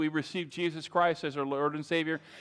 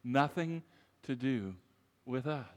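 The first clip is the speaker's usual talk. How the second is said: softer, lower, with pauses between phrases; quiet repeat; 5 semitones lower, 5 dB quieter than usual. Real, not acted. Preacher repeating the point to the congregation, quiet and deliberate. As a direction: let it sink in quietly, phrase by phrase. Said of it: "I'll say it again".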